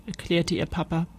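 ordinary delivery, nothing marked

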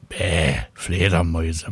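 a tomcat turning up his nose, meowing it; maunzend. A narrator's reading. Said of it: "maunzt Willi". (Kater maunzt angewidert, tief und langsam)

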